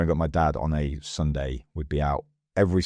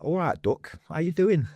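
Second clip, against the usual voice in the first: high, broad Burton-on-Trent greeting; chirpy Burton-on-Trent local saying hello; Burton-on-Trent accent; 13 semitones higher than usual. Retold or imitated. Mimicking hometown folk, Burton-on-Trent accent. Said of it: "everyone from Burton-on-Trent is like".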